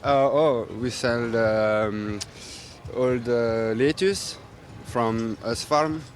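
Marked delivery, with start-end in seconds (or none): none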